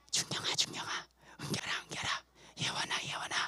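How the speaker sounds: hushed, coaxing whisper of names; whispered temptation. Acted. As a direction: whisper each name twice as the tempter. Acts out sin whispering names. (이름을 부르며 속삭이는 유혹)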